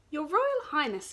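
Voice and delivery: wolf hails the princess; grand address. Acted. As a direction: announce it with a bow. Wolf bows formally.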